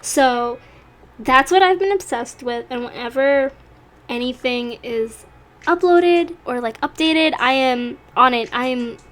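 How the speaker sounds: ordinary delivery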